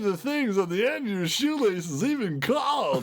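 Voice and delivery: very high, theatrical cartoon-cat voice; grand, high-pitched cartoon showman; Snagglepuss. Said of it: "goes right into Snagglepuss".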